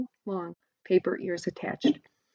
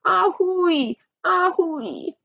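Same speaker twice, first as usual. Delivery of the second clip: much higher, heartfelt train wail; feeling wail; 11 semitones higher, much slower than usual. A narrator's reading. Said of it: "wailed ... with great feeling".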